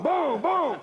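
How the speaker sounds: imitated gunshots.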